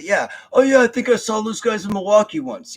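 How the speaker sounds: loud, high gullible fan voice; dumb fan. Retold or imitated. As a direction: play the easily fooled crowd. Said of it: "they'll convince themselves that".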